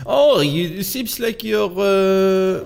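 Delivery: put-on a German accent that slides into French; failed German accent. Streamer voicing the German man in his computer, failed German accent. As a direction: try a German accent and miss. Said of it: "Why can I not do a German accent?".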